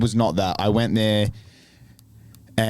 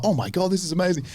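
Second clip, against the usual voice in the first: thrilled; 9 semitones higher than usual. Retold or imitated. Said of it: "And I was like".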